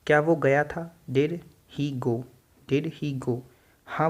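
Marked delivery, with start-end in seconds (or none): 1.1-2.2 very slow, each word spelled out; slow drill. Shown as a demonstration. Teacher slowly modelling a question for students. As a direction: drag it out word by word for learners. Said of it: "fast बोलना है इसको".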